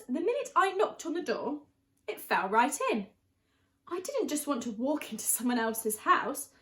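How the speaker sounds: usual speaking voice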